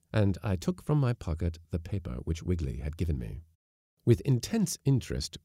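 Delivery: ordinary delivery, nothing marked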